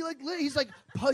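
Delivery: ordinary talk, nothing marked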